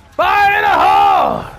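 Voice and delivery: long, high battle cry shout. Real, not acted. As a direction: yell it as you throw. Throwing remote explosives at enemies.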